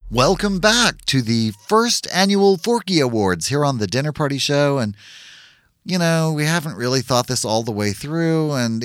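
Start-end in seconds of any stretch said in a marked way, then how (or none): 0.1-7.4 upbeat host welcome; award show host. Acted.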